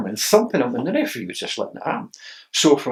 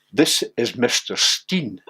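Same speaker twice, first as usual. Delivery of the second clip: slow stern correction; much slower than usual. Retold or imitated. Caller firmly corrects, slow.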